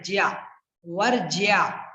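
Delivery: ज्या, then slow वर्ज्या with "jyaa"; corrected pronunciation. Shown as a demonstration. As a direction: stretch the y-glide in वर्ज्या. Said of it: "ज्या बोलना है". (वर्ज्या के अंत में साफ़ 'ज्या')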